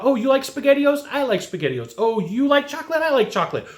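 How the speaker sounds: high, eager echoes of the buyer's likes; mock eager rapport-builder. Mocking a salesperson's copycat small talk, high and eager. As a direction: parody the salesperson who copies everything. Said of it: "it's all about".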